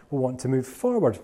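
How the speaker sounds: ordinary delivery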